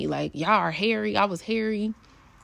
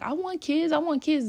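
mocking wannabe parents; 9 semitones higher than usual. Mocking people who want kids.

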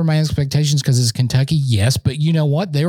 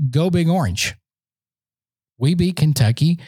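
usual speaking voice

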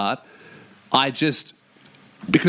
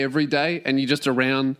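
usual speaking voice

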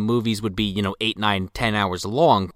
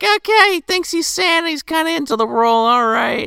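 high, breezy dismissive voice; shrugging crew; 3 dB louder than usual. Retold or imitated. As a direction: brush it off lightly.

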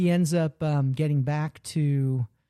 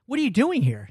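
mock startled question; 8 semitones higher than usual. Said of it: "And he was like".

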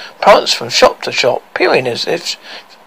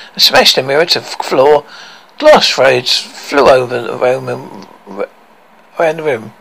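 ordinary delivery

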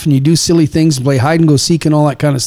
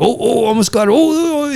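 high-pitched, hammed-up suspense; playful big deal; 10 semitones higher than usual. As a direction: swoop way up as if it nearly worked. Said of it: "we're making a big deal of it".

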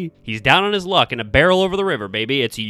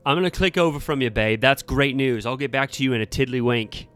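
loosely British, quick and louder; sort-of British accent; 5 semitones higher, 5 dB louder, faster than usual. Acted. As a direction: rattle it off in a half-hearted British accent. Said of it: "suddenly adopting a British accent, sort of".

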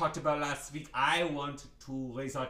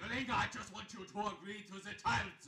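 rushed plea; 7 semitones higher, 6 dB quieter, faster than usual. Acted. Pleading as she leaves.

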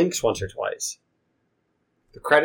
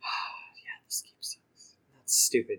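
hushed, whispered complaint; deflated grumble; 10 dB quieter, slower than usual. Real, not acted. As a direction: sigh it out under the breath, barely voiced. Grumbles after learning one whip wins.

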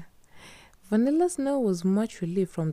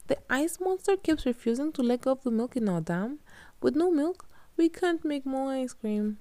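Katya's voice; 9 semitones higher than usual. A narrator's reading. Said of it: "said Katya".

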